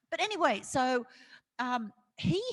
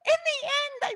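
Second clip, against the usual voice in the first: laughing; 5 dB louder, faster than usual. Real, not acted. Still laughing.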